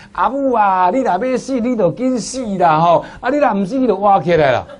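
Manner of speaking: high, loud Taiwanese plea, ending in a laugh; son-in-law in Taiwanese. Retold or imitated. Son-in-law urges his mother to die or come back, high and loud in Taiwanese. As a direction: call out to the old mother in Taiwanese, half-joking. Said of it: "他是用台语讲啊".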